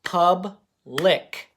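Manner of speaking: "pub-lick" clapped as two beats; teacher clapping "pub-lick"; clapped syllables. Shown as a demonstration. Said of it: "gonna clap it".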